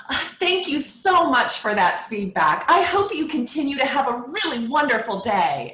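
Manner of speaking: high, loud, syrupy fake gratitude; sickeningly sweet. Shown as a demonstration. Phone seller answering an insulting caller, sickeningly sweet. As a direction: gush with exaggerated, sugary politeness. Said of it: "do my sickeningly sweet voice".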